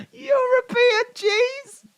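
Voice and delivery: very high, slow wheezing laugh; wheezy voice. Real, not acted. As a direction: wheeze the words out while laughing. Laughing fit.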